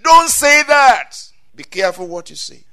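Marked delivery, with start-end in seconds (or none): 0.0-1.1 high, loud, slow rebuke; firm command. Real, not acted. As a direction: stop them hard. Preacher forbids emphatically.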